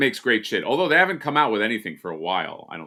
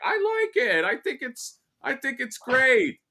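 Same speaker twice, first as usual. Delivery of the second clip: very high-pitched contrarian squeal; Ryan Dole. Acted. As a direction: push the impression way up high. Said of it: "to do an impression of Ryan Dole".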